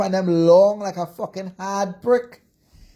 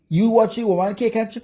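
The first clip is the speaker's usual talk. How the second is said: normal talking voice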